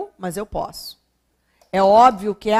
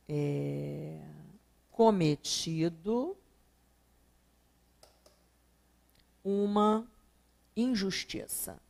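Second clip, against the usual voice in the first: slow dictation; 3 semitones lower, 7 dB quieter, much slower than usual. Shown as a demonstration. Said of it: "teacher reads the example sentence".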